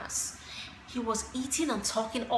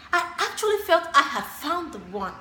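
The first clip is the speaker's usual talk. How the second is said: higher-pitched, emphatic declaration of romantic hope; gushing; 4 semitones higher than usual. Acted. Telling a friend about the dream date, gushing. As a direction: say it with full, lifted conviction.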